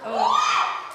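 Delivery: high squeak of surprise with a laugh; surprised oh. Real, not acted. Daughter startled at the microphone, squeaky surprised oh. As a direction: yelp as if caught off guard.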